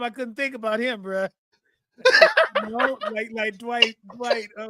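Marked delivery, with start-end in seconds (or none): 2.0-2.6 loud laugh. Real, not acted. Laughing at the memory.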